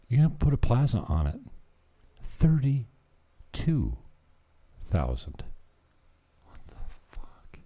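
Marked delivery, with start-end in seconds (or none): 2.2-5.4 drawn out. Acted. Announcing the lot price. 6.4-7.6 whisper. Real, not acted. Muttered reaction to himself.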